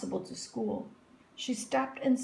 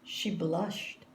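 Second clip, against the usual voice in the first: ordinary delivery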